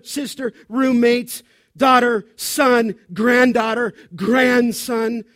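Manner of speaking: loud, punchy exhortation; forceful preaching. Real, not acted. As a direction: hammer each phrase at the congregation.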